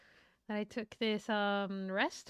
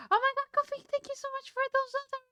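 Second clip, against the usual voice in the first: high, fast, excited thanks; excited high squeal; 11 semitones higher, 4 dB louder, much faster than usual. Real, not acted. Streamer thanking Coffee for bits, high excited squeal.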